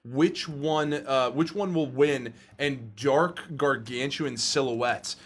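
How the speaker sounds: low, measured scene description; scene narration. A narrator's reading. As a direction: paint the monster slowly and ominously. Game master describes the monster.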